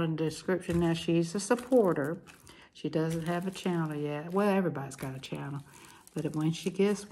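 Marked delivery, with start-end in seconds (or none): none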